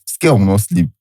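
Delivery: normal talking voice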